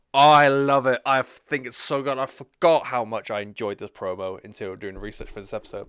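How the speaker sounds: excited gushing. Real, not acted. Fan raving about Neville's promo, loud and delighted. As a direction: rave about it like a thrilled fan.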